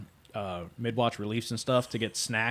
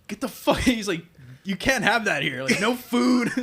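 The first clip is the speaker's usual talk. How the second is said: high, loud yelling as the angry supervisor; mock yell; 9 semitones higher, 5 dB louder than usual. Supervisor yelling at the sailor with a corn dog, loud mock yell. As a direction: bark it out like a furious boss.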